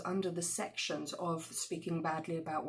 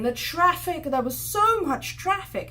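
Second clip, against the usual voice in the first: louder and much higher complaint; exaggerating; 9 semitones higher, 8 dB louder than usual. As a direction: overstate it for effect. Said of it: "And he's saying".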